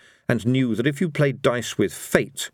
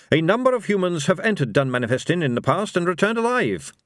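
high, earnest character voice; pitched-up reading from notes; Carrot, earnest briefer; 9 semitones higher than usual. Acted. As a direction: report it dutifully and hopefully.

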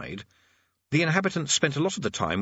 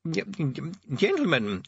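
put-on halting, higher old man's opening; hesitant stammer; 6 semitones higher, slower than usual. Old Arch-Chancellor opens a meeting, stammering.

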